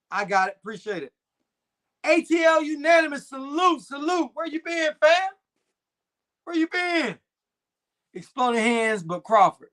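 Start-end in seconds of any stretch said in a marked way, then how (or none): 2.0-7.2 excited shout-out. Real, not acted. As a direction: call out a happy greeting to a regular. Shouting out a returning viewer.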